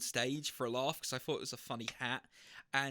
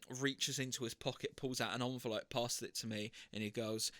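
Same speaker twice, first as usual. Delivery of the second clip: ordinary talk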